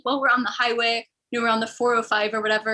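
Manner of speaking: normal talking voice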